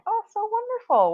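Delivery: excited praise. Real, not acted. Cheering viewer's reading progress.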